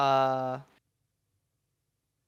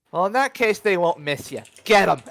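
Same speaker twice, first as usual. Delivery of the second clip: raised, higher voice building to a shout; shouted attack order; 6 semitones higher, 9 dB louder than usual. Acted. Gang boss sets his men on the intruder, shouting.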